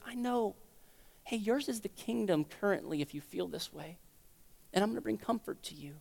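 soft, hushed reassurance; gentle comfort. Acted. God promising comfort to a hurting believer, gentle hushed voice. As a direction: soothe them quietly.

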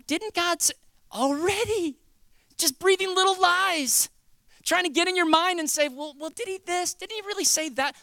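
high, put-on doubting voice; insinuating serpent. Serpent questioning what God said to Eve, insinuating. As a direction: needle with high-pitched little questions.

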